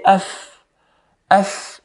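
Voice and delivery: af with a long breath of air on the f; airy fa. Shown as a demonstration. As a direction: let air flow out through the f of af. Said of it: "you notice air flows with it".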